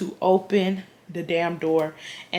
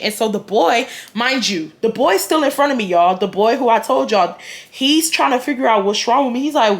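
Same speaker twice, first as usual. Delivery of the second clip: ordinary talk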